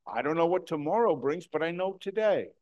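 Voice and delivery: usual speaking voice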